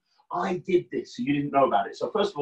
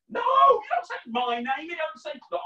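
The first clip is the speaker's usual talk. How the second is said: playful shout; 13 semitones higher than usual. Acted. Correcting kids, playful exasperation.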